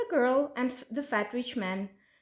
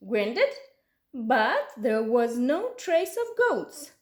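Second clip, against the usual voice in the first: ordinary delivery, nothing marked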